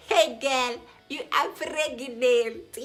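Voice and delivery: loud, high, slow joyful news; exuberant announcement. Retold or imitated. Doctor telling the patient she is pregnant, loud and high. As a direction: announce it like a celebration.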